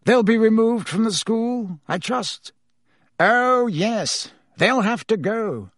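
lightly voiced dialogue; dialogue voices. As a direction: trade questions lightly. Dialogue Dumbledore and Fudge.

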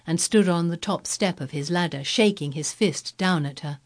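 ordinary delivery, nothing marked

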